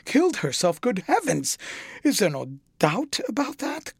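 the painter crying out at news of a death; shocked cry. A narrator's reading. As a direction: gasp it out in horror. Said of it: "cried Hallward".

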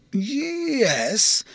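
long drawn-out, high, swooping yes; dubious, musical yes; doubtful assent. A narrator's reading. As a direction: stretch it out as a grudging concession. Dean weighs the word doubtfully.

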